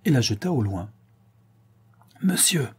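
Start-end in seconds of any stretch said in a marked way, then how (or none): 2.2-2.7 sharply higher outcry of Monsieur; cry out. A narrator's reading. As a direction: cry out in offended anger. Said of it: "s'écria le gros homme". (cri indigné, voix plus aiguë)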